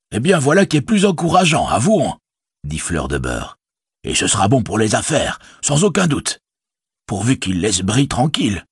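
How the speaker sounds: a worried village innkeeper speaking up; innkeeper Butterbur. Acted.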